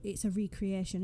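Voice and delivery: ordinary talk, nothing marked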